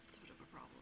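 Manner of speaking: whisper. Real, not acted. Whispered aside after hell joke.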